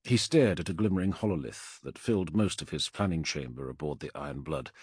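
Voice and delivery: ordinary delivery, nothing marked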